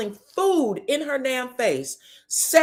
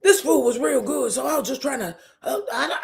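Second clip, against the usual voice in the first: mock talking round a chicken wing; imitated mouth full; 3 semitones higher than usual. Nell caught with chicken wing in mouth, muffled excuse. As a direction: stumble through a pretend mouthful. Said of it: "Nell got that chicken wing in her mouth".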